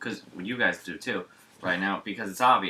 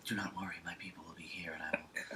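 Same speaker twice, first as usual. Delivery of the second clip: hushed, lower, whispered promise; mock tender whisper; 4 semitones lower, 14 dB quieter than usual. Co-host mocking the cop soothing the starlet in a whisper. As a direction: whisper it like a hammed-up protector.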